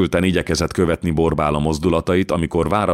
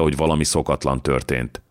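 ordinary delivery

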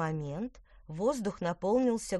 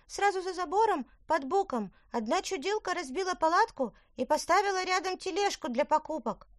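meek high voice; 12 semitones higher than usual. Acted. Sparzhinzon voice again.

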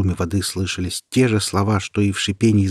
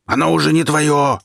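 higher, louder angry yell; furious shout; 8 semitones higher, 5 dB louder than usual. Acted. Sorcerer Michel shouting at Peter, angry yell. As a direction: bellow it at the thief. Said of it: "закричал Михель".

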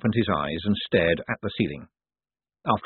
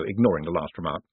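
usual speaking voice

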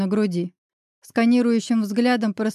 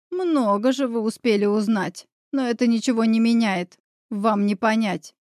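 usual speaking voice

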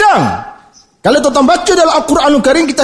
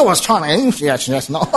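put-on "Yes, yes, no" said low and much softer in a clipped English accent; a deep, muted send-up of an English accent; mock English speaker; 9 semitones lower, 6 dB quieter, much slower than usual. Preacher mocks English spoken from the tip of the mouth, in a low quiet English accent. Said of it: "Bahasa Inggeris main hujungnya".

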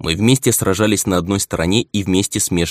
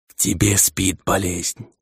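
slow spirit voice; slower than usual. Acted. The dead head speaks.

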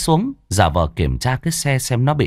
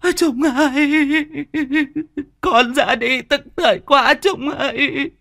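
wailing grief. A narrator's reading. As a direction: wail like a bereaved mother mourning her son. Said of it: "ôm lấy di ảnh của con mà gào lên". (gào khóc thảm thiết)

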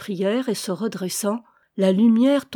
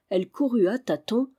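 plain reading voice

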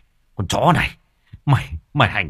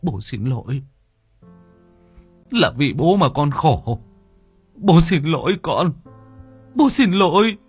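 father's apology swelling into weeping; tearful apology; 7 semitones higher, 3 dB louder than usual. Acted. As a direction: apologize to your son as the tears come.

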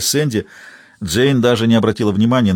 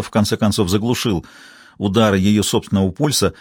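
usual speaking voice

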